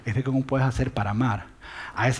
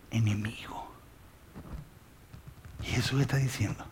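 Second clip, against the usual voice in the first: dramatic whisper; 5 semitones lower, 12 dB quieter than usual. Acted. Preacher to the congregation about the worst enemy, whispered. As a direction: whisper enemigo, unvoiced and soft. Sermon climax on loving enemies.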